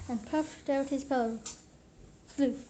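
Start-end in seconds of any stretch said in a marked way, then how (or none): none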